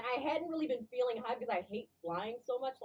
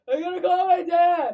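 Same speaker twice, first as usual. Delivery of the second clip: loud, drawn-out mock panic; exaggerated panicked shout; retold freak-out; 6 semitones higher, 10 dB louder than usual. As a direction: wail it out high and slow, hammed-up. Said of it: "I was freaking out".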